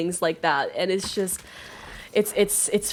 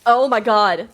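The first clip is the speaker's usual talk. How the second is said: loud, slow, frightened; scared; 3 semitones higher, 5 dB louder, much slower than usual. Real, not acted. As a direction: say it shaken with fear. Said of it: "I am so scared".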